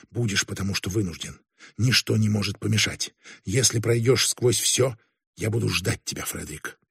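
soft and low, fading away at the end; barely audible. A narrator's reading. A woman promising Frederick she will wait, barely audible. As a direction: let the voice sink until the name almost vanishes. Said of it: "еле слышно произнесла она".

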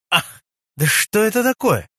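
startled cry of discovery, pitched high; sudden exclamation. A narrator's reading. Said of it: "Сделав это восклицание".

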